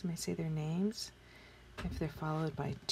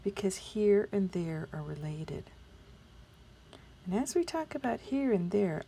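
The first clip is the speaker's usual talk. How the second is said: usual speaking voice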